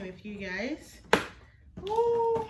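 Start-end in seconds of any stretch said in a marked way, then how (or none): 1.9-2.5 excited. Real, not acted. Unboxing excitement.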